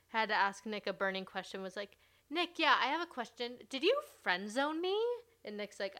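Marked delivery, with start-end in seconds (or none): 2.3-5.0 put-on higher, louder needy girl voice; mocking Lacey. Podcaster mocking Lacey's question to Nick, in a higher, louder needy voice.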